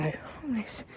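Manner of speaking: ordinary delivery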